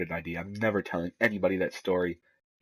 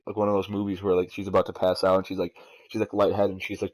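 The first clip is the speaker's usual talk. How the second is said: usual speaking voice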